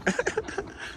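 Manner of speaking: high, soft giggle; breathless giggle after nearly tripping; laugh. Real, not acted.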